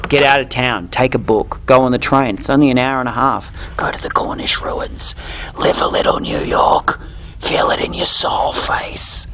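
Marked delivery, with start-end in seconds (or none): 3.8-9.3 hushed dramatic whisper. Acted. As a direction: whisper it like a secret. Whispered advice at the end.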